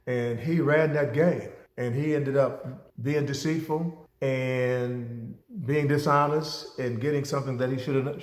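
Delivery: usual speaking voice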